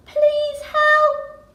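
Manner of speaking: very high, loud frantic scream; high, loud panicked plea; panicked cry for help. Acted. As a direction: shriek for help like a drowning young cheetah. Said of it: "cried the cheetah in panic".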